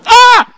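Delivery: high, loud mock scream of frustration; hammed-up cry of frustration; frustrated shriek. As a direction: let out a shrill exasperated shriek. Frustrated outburst over sinning.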